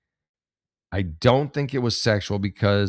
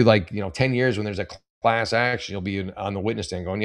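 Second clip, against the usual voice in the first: ordinary delivery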